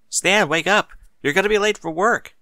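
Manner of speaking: wife calling husband awake, high and loud; urgent wake-up call. Acted. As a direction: rouse him, it's late. Mary urgently wakes husband.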